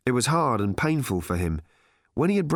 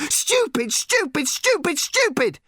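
high, furious repeated shriek; screamed tantrum; 4 dB louder, slower than usual. A narrator's reading. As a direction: scream each word in a childish rage. Daughter screams at her father.

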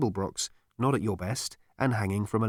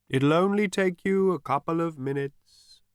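ordinary delivery, nothing marked

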